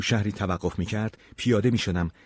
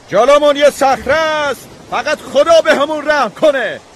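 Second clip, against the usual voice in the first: very high, full-force panicked yell; captain's desperate shout; 13 dB louder than usual. A narrator's reading. Captain warning the ship of a rock ahead, desperate shout. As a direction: cry out as disaster looms. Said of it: "ناخدا داد زد".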